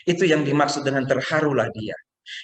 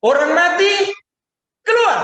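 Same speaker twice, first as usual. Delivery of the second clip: very high, loud joking command; commanding shout; 8 dB louder than usual. Acted. Imagined Jesus shouting to all the dead, a loud joking command. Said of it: "bayangin kalau dia bilang begini".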